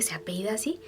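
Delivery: whispered to herself in disbelief; a secret question only she can hear; inner whisper. Retold or imitated. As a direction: breathe it as a private thought. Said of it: "dentro de mí dije".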